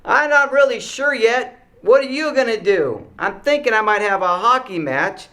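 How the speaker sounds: high, loud imitation of a kid shouting; yelling back. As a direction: holler back across three tables. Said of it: "the person's yelling back".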